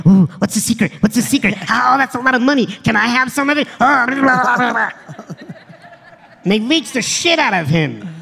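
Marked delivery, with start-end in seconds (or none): 0.1-3.7 mock fawning leech; mocking clingy fans. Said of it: "like, clingy as shit and like". 3.8-4.9 laughing mock babble. Mocking the leeches' chatter.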